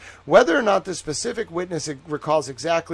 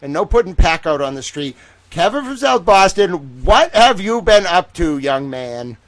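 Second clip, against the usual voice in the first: mock scolding; 3 semitones higher, 8 dB louder than usual. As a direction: call him out like a playfully scolding parent. Mock scolding Kevin from South Boston.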